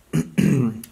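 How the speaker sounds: quick clearing of the throat. Real, not acted. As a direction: clear your throat once. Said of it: "grunting and throat clearing".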